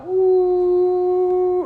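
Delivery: high, long steady ooh imitating a shofar; long shofar blast. Shown as a demonstration. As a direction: hold one high note like a shofar blast. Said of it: "Tkiyah is the long sound".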